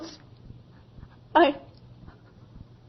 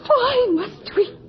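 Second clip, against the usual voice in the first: tearful protest; 7 semitones higher than usual. Acted. Terrified girl protests.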